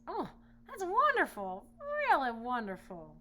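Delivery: high, loud, slow delight; delighted lion. Acted. Lion thrilled by his braided mane, high and loud. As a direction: gush with joy. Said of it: "said the lion".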